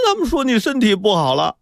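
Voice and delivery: high-pitched mock sobbing child; acted tearful choking. A narrator's reading. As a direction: wail it like a crying boy. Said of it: "哭得直哽咽".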